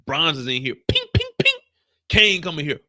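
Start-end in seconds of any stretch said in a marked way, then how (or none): 0.9-1.5 very high, sharp ping sounds; high pinging gunfire noises; silenced gunshots. Retold or imitated. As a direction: mimic a silencer firing. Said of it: "That's the sign of the silencer".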